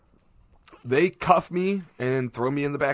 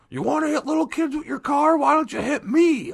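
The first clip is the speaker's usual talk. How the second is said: high mock yell of an outraged bystander; retold screaming; 5 dB louder than usual. Angry bystander yelling at the driver, high mock shout. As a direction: shout it up high like a furious stranger. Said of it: "gets out of his car and starts screaming".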